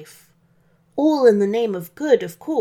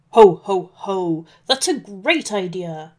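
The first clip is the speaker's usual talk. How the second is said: loud opening ho-ho, then easing off; Santa's shout; 4 dB louder than usual. A narrator's reading. Santa welcomes the wolf's chimney idea, briefly shouted delight. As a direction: exclaim as Santa, booming only on the laugh. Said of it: "shouted Santa".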